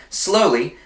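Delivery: ordinary delivery, nothing marked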